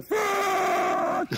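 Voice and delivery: long, very high yell; hammed-up cry of horror, held out; anguished mock shout. Father standing over fallen baby.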